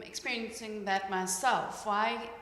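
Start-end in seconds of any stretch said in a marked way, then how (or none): none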